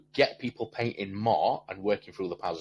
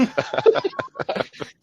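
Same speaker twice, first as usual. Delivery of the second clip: high laugh; 7 dB louder than usual. Real, not acted. Laughs after the kid voice.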